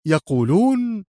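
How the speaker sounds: high-pitched incredulous echo; scornfully astonished question; indignant disbelief. A narrator's reading. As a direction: query the word in indignation. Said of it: "هتف المدير مستنكرا".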